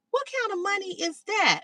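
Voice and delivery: high-pitched, innocent puzzlement; puzzled retold question. Girl asks her date about unfamiliar money, puzzled. Naive question at food stamps.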